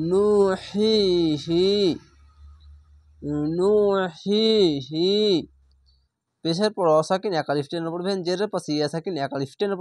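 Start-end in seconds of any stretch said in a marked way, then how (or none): 0.1-1.7 নুহিহি drawn out slowly, the হি breathed from the throat; a slow reciting chant; slow recitation. Shown as a demonstration. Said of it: "এই হা উচ্চারণটি". 3.6-5.1 নুহিহি drawn out slowly, the হি breathed from the throat; a slow reciting chant; slow recitation. Shown as a demonstration. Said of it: "এই হা উচ্চারণটি".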